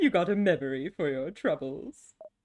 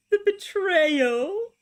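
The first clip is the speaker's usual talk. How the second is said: mock dramatic; 11 semitones higher, 6 dB louder, much slower than usual. Hammed-up melodrama.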